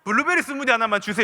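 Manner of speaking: Gyeongsang dialect with a loud, punchy 블루베리; a father ordering in his Gyeongsang accent. Retold or imitated. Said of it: "경상도 사람들 그거 아세요".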